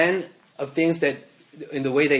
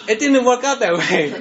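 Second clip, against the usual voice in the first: laughing it off, higher and louder; 8 semitones higher, 8 dB louder than usual. Real, not acted. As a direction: laugh at your own failed plan. Laughing at failed plan.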